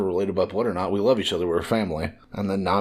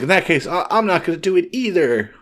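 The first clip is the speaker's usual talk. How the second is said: high, louder imitated kid; stubborn kid; 8 semitones higher, 4 dB louder than usual. Child refuses food like mother, imitated stubborn kid. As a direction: play a child digging in, pitched up. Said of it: "you know how kids are".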